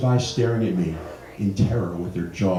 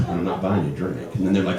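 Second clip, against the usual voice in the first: ordinary delivery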